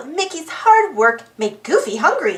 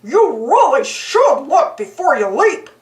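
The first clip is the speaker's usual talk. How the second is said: lightly voiced goofy dog. A narrator's reading. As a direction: say it like a dopey good-natured dog. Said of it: "Goofy said to the frog".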